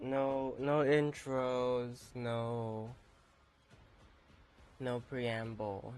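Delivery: ordinary talk, nothing marked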